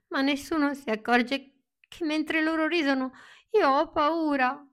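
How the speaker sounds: a small voice hiding its fear; frightened thought. Acted. Pinocchio's frightened thoughts in circus.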